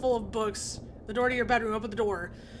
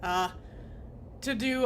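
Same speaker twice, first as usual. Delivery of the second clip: ordinary delivery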